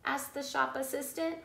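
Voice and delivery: usual speaking voice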